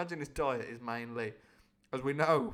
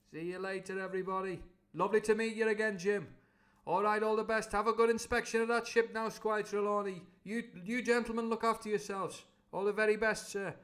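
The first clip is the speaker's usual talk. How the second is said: high, louder cheery cook; Long John goodbye; 7 semitones higher, 4 dB louder than usual. Acted. Long John bowing out to the gentlemen, loud and cheery. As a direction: fawn over them as you leave.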